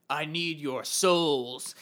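very slow, drawn-out monster voice; hammed-up undead threat, stretched out; menacing mummy. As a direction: intone it like a risen mummy. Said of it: "the mummy comes out".

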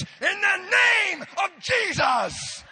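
high, slow, booming mock prayer; shouted prayer. Evangelist praying over a woman on a plane, drawn-out mock shout. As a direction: bellow it like an over-zealous evangelist. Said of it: "as he shouted".

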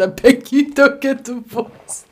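high, slow, hammed-up plea; mock your own words slowly. Friend mocking her own plea, high and slow. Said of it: "No, I just said".

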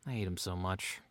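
normal talking voice

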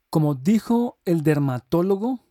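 ordinary delivery

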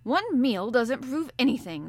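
a grave harpooner warning the professor. Acted. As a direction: say it slowly, with heavy conviction. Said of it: "the Canadian replied gravely".

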